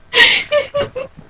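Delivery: high, helpless giggle; can't stop giggling; high giggling laugh. Real, not acted. Apologizing for laughing, high helpless giggle.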